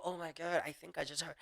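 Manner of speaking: soft, sinking realization; hushed, guilty self-reproach; retold regret. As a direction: let it drop quietly, as if privately regretting it. Retold regret after hurtful jokes.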